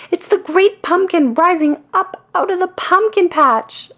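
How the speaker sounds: very high, loud cry of joy; excited yell. A narrator's reading. Linus seeing the shadow rise, yelling with joy. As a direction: shout it in wonder. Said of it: "Linus yells".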